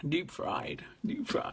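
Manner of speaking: put-on whispered Scottish accent warm-up. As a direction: mutter your way into a Scottish accent. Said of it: "I have to get back into character".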